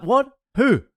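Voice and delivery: high, quick surprised questions; startled reaction. Retold or imitated. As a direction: act out being caught off guard.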